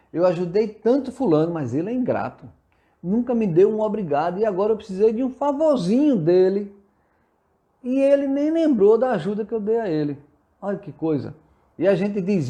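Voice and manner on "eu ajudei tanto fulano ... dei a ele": imitating someone griping, pitch climbing; mock-aggrieved, higher as the grievance builds; indignant complaint. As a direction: complain like a wronged benefactor.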